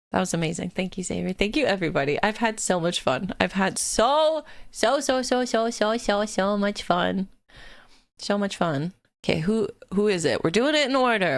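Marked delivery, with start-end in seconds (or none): 3.4-7.3 so stretched out slow and rising; drawn-out gushing. Real, not acted. Streamer thanking chat, dragging out so. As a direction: keep repeating so, slowly building. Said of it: "stretched repeated so".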